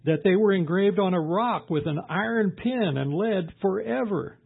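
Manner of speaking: high, loud declaimed scripture; impassioned cry, pitched well above normal talk. Retold or imitated. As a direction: proclaim it with rising fervour. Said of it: "Job cries out and says".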